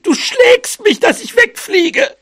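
tearful, extremely high mouse wail; scolding. Acted. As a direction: scold in a piercingly high, wailing voice. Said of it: "schimpfte sie". (schimpfend, weinerlich und ganz hoch)

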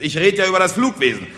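loud, high orator's shouting; flustered speaker building to a shout; shouted insistence. Acted. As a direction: drown out the hecklers with a rising harangue. Heckled orator insists to crowd.

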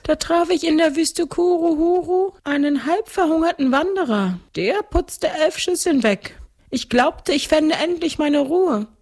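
very high ghost-count voice; gluttonous ghost count. Acted. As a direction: recount it as a high-pitched ghost nobleman. (hohe Stimme eines Gespenstergrafen)

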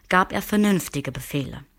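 usual speaking voice

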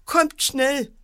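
high, mildly wailing cry for help; howling scared boy. A narrator's reading. Frightened boy calls for his parents, high wail. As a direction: call out high, as a frightened child. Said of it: "begann fürchterlich zu heulen".

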